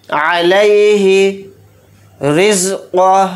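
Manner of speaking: slow, measured recitation of अलैहि रिज़्क़ुह; Quran recitation. Shown as a demonstration. Teacher slowly reciting the verse for students. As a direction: draw each syllable out slowly.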